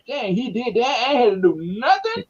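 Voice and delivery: high-pitched, marveling mock quote; imitating Trey. Host imagining Trey sitting back relieved, high mock amazement. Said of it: "Trey can just sit back and be like".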